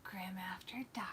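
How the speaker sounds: whispered, breathy and secretive; whispering. Real, not acted. Teasing rename of show.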